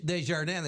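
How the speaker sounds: Desjardins as French day-zhar-DAN; French pronunciation. Retold or imitated. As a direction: say Desjardins with a silent final S and nasal ending. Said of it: "start speaking French".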